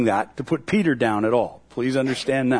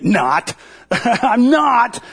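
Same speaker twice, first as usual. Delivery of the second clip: higher, louder flat refusal; blatant rebuttal; 5 semitones higher, 4 dB louder, slower than usual. Retold or imitated. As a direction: slam the door on it. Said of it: "a much more blatant rebuttal".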